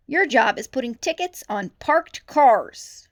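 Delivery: loud, slow, stern rebuke; scolding. A narrator's reading. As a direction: put her in her place. Chief scolding rookie.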